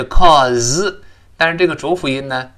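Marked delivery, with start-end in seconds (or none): 0.0-1.0 "cause" as "calls", long vowel and buzzed final z; full voiced ending. Shown as a demonstration. Teacher shows the original ending, "cause" with full voiced z. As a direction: draw out "cause" with a clear z at the end. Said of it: "后面加S本来叫".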